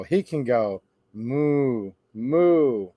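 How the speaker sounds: low, slow moo sound; mooing like a cow. Acted. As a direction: draw it out like a cow. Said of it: "He can sound like a cow".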